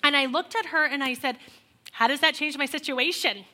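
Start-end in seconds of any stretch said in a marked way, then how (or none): 1.9-3.5 louder and higher, demanding answers; going off on the mother-in-law; louder, higher, rising anger; angry confrontation. Retold or imitated.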